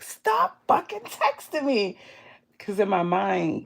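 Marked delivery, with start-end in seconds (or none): 0.0-2.0 much higher, slow, drawn-out "Stop fucking texting me."; high exaggerated snap; mock outburst. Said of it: "And then I was like".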